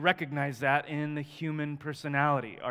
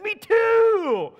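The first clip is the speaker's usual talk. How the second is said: squeaky, much higher eager cry; delighted; 11 dB louder than usual. Retold or imitated. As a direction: chirp it out, thrilled to agree. Delighted at shared faith.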